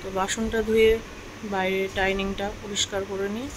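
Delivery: hushed so grandma can sleep; speaking softly. Real, not acted. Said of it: "ওই জন্য আস্তে আস্তে আমি কথা বলছি".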